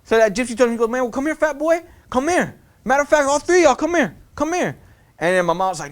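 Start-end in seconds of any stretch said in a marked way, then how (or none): 0.9-4.8 higher-pitched retold call-over. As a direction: lightly voice someone summoning them over.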